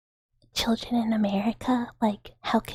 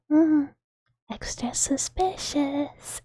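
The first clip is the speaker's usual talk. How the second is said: mock suspicion; 5 semitones higher, much slower than usual. Playing up suspicion about the outage.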